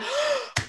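a short, high shocked gasp; sharp gasp. Retold or imitated. Hearing a stranger's tragic story, a sharp high gasp. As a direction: catch your breath in shock.

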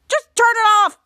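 loud, high, tearful "just turn it off"; upset crying shout. Retold or imitated. Ray after the spoiler text, loud tearful wail. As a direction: wail it like the night is ruined.

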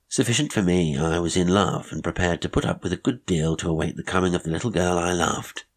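ordinary delivery

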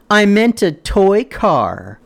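high, slow, gloating dad voice; mocking high dad gloat; smug dad. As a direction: sneer the punchline like a trickster dad. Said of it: "And then it's like".

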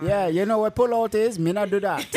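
put-on loud joking Jamaican accent. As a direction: ham up a Jamaican dad. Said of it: "with a thick Jamaican accent".

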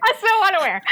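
high, mocking sing-song; squeaky mock of herself. Repeating the mock line.

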